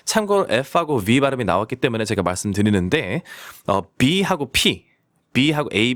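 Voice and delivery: ordinary delivery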